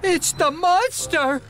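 panicked cry. Acted. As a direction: cry out in sudden fright. Toby panics at the monster.

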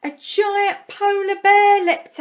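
high, loud and slow, the bear bursts out; dramatic reveal. A narrator's reading. Narrator reading to children reveals the bear, high, loud, slowed. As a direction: make the listener jump. Sudden danger in the story.

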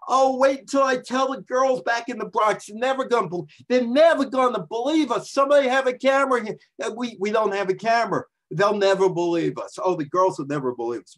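high, breathless fan chatter; excited Bronx ladies. Pretend. Two Bronx women thrilled after meeting Joe, high and gushing. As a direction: gush about telling the girls back home.

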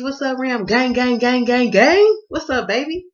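loud, high hype shout; hyped shout-out climbing to a squeal. Acted. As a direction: yell the gangs out, building to a peak.

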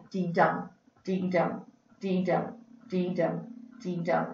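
"dee dum" repeated as five even, separated beats; beating out rhythm. Shown as a demonstration. Teacher showing students how to beat out iambic pentameter, rhythmic chant. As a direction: count out each "dee dum" steadily, a small pause between pairs. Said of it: "I want you to kind of beat out".

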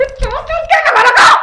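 loud, furious shout; wife shouting at husband over the theft accusation; angry shout. Acted. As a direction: yell it back at him in outrage.

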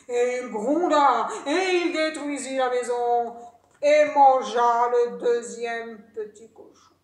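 loud, drawn-out narration; heavy, menacing storyteller; dramatic storytelling. A narrator's reading. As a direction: boom out each deed of the wolf. Narrator acts out the wolf's attack.

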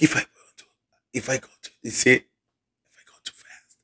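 words fading into voiceless air; voice giving out. Shown as a demonstration. Host shows viewers what the lost voice does, cutting out to a whisper. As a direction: let the voice drop out mid-phrase. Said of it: "they start going like that".

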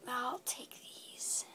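slow sneaky whisper. Acted. Shopkeeper grabbing items before leaving, slow whisper. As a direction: whisper it slowly, barely voiced.

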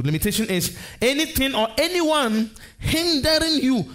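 "anyone" lifted high with weight; stress on ANYONE. Real, not acted. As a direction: preach it high, leaning hard on "anyone". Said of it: "I put emphasis on anyone".

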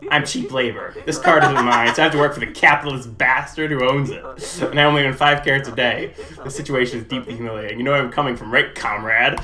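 loud, laughing worker donkey complaining; loud, half-laughing donkey voice; grumbling donkey. Acted. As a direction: rant like an exploited comrade donkey. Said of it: "Why doesn't this donkey sound like Eddie Murphy?".

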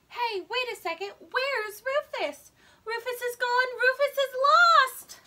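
building alarm, ending in a loud high wail; panicking child discovers dog gone; panicked alarm. Acted. Realizing Rufus is missing.

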